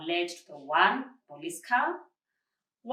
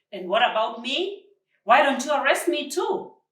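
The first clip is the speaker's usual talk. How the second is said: higher, louder indignant disbelief; incredulous uncle, raised and pitched up; disbelieving challenge; 6 semitones higher, 8 dB louder than usual. Acted. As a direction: dare them to arrest him too. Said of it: "There was disbelief in his voice".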